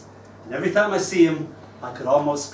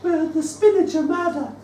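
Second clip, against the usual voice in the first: much higher, eager schoolkid voice; child pupil. Retold or imitated. Pupil answering the teacher, high and eager. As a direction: pipe up like a keen year six pupil. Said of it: "And they said".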